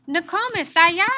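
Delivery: put-on high, loud boyish call; excited shout. A narrator's reading. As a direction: call out to grandmother eagerly. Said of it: "he shouted".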